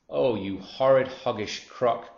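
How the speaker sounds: outraged storybook monkey, relishing each word; indignant cry. A narrator's reading. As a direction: cry out the insult slowly and emphatically. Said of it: "cried Mugglewump".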